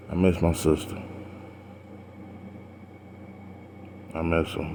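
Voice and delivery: hushed, breaking off between words, barely holding it together; quiet grief. Real, not acted. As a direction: say it as if it hurts to speak. Grieving his late sister.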